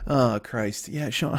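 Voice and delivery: ordinary talk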